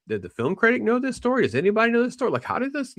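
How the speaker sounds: high, puzzled questioning; mock bewildered audience voice; incredulous inner voice. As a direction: raise the pitch way up as a stunned viewer wondering.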